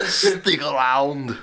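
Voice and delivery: slow, drawn-out action-hero quip; Arnold one-liner. Retold or imitated. Host quoting Predator, slow Arnold one-liner. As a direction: deliver it dead slow like Arnold. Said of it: "He's just like".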